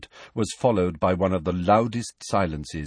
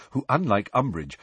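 ordinary delivery

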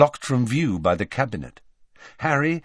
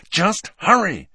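high, loud urging; impatient; 10 semitones higher, 5 dB louder than usual. A narrator's reading. Harry hurrying his friends under the cloak, impatient. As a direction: push them along sharply. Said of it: "said Harry impatiently".

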